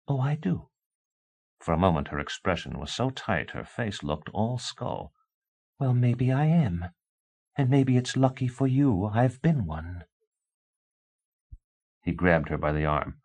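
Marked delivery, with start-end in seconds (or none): 0.1-10.0 hint at being a witch; cold wife. A narrator's reading. A wife coldly telling her husband she may be a witch.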